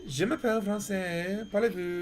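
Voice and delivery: light, lilting Parisian French imitation; Parisian French accent. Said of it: "like, the Parisian French, which is like".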